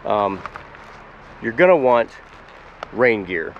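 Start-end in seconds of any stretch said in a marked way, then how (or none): none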